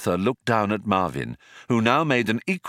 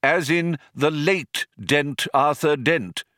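put-on slow, higher, mildly stern old man; 7 semitones higher, slower than usual. A narrator's reading. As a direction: spell it out slowly with some sternness. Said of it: "said the old man sternly".

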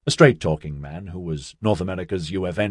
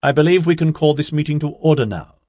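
higher, louder call to order; raised voice; 8 semitones higher, 6 dB louder than usual. A narrator's reading. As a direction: project over a chattering room. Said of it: "raised her voice above the burble".

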